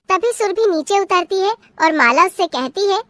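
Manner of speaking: ordinary talk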